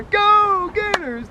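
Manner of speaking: long high shouted cheer, drawn out slow; stretched stadium yell; team cheer. Acted. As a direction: roar it for the Gators.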